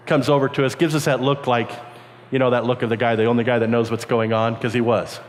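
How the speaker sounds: normal talking voice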